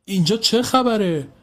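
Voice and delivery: high, confused question; puzzled neighbour wanting to know what's happening; bewildered. A narrator's reading. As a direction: ask it baffled, just woken by the fuss. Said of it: "که گیج و مبهم مونده".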